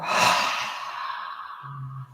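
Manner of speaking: long, slow airy exhale; demonstrated deep release of breath; letting-go sigh. Shown as a demonstration. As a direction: sigh it all out without voice. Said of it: "let it all go".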